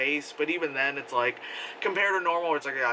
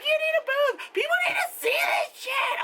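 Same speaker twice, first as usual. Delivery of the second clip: high, loud insistent fan voice; imitating a fan yelling encouragement; mock pushy yell; 3 dB louder than usual. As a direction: bark it at her like a pushy mom. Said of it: "felt like I was being screamed at".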